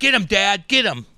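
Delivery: loud, high egging on; cheering kid. Retold or imitated. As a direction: urge dad on like an excited boy. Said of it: "I'm like".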